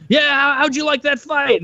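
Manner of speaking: high, hammed-up clueless interviewer voice; mock interviewer. Mocking the interviewer asking the dazed fighter, high and hammed-up. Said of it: "you walk over and like".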